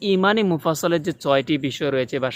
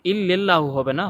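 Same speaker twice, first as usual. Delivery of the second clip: usual speaking voice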